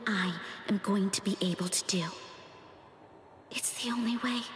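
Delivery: soft resolved whisper. Acted. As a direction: breathe it out quietly. Quiet resolve to herself.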